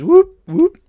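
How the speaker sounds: high, loud little whoop of delight; mock cheer. Real, not acted. Podcaster cheering his own comic news, high and loud. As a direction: cheer it out like a giddy fan.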